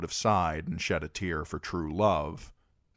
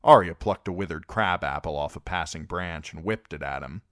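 plain reading voice

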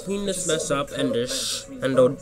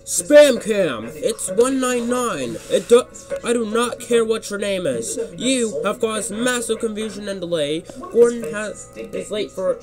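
high-pitched, outraged telling-off; annoyed and outraged; 9 semitones higher than usual. A narrator's reading. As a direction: scold a troublemaker indignantly. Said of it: "He was very annoyed and outraged".

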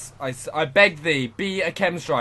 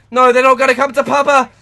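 loud high cry of protest; dismayed shout; 7 semitones higher, 11 dB louder than usual. Real, not acted. Gamer watching his chance slip away, dismayed shout. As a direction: shout no at the screen. Enemies won't come.

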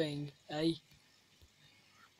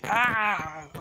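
sudden higher, louder "ah" of dismay; frustrated cry; 4 semitones higher, 5 dB louder than usual. Real, not acted. Player going one block too far, exasperated yelp. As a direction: cry out at your own slip.

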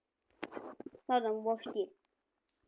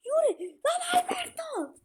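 frustrated shout; 11 semitones higher than usual. Real, not acted. Gamer furious that Alberto ignored his pass, anguished shout. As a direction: cry out in despair at him.

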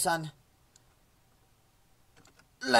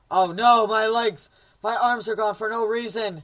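high, loud hammed-up distress; mock panic; 4 semitones higher, 7 dB louder than usual. Game character losing legs and arms, mock-panicked cry. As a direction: wail in comic horror.

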